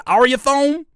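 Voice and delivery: "Ariathone" run together as one word, rising; puzzled mispronunciation. Shown as a demonstration. Streamer unsure of the name, "Ariathone" as one questioning word. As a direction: ask "Ariathone" loud and high, ending in "-thone".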